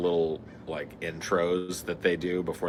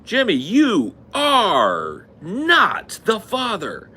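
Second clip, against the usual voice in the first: high, loud, very slow reveal; a hammed-up host reveal, louder and higher; Maury reveal; 13 semitones higher, 7 dB louder, much slower than usual. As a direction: stretch every word of the verdict. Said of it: "He'll be like".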